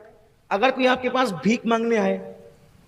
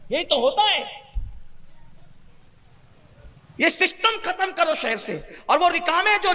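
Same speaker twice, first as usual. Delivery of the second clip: raised, urgent pulpit voice; fervent preaching; 3 semitones higher than usual. Acted. Preacher urging listeners to stop child begging, fervent and raised. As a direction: rouse the congregation against child begging.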